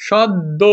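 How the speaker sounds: সদ্য split as "shod-do"; two beats on সদ্য with a held d; doubled d. Shown as a demonstration. Teacher sounding out syllables, সদ্য as "shod-do". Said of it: "দো".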